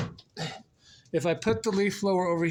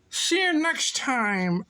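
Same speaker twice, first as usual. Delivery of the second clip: slow, high, slurred farewell; silly sign-off; 6 semitones higher, slower than usual. Pretend. Goodbye to viewers as alligators, silly drawn-out voice. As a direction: stretch it out high and goofy.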